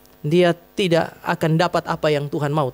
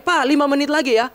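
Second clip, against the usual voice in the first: imitating a child's call, pitched high; anak berteriak; 7 semitones higher than usual. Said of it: "Selesai itu dia akan teriak". (menirukan anak berteriak dengan nada tinggi)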